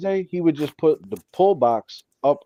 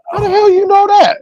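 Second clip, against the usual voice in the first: much higher and louder, stunned question; mock astonished shout; amazed; 11 dB louder than usual. A dad in the chat, stunned that he guessed the shop, amazed.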